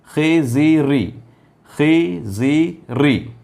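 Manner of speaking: each syllable stretched, very slow; slow syllable demo. Shown as a demonstration. Teacher sounding out zer syllables, very slow. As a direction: spell it out slowly. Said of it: "खै ज़ेर खी".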